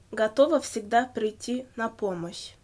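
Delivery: ordinary talk, nothing marked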